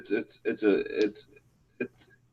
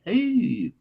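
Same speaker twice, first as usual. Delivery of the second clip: Pepe Le Pew; 9 semitones higher, 4 dB quieter than usual. Retold or imitated. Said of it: "sound like Pepe Le Pew".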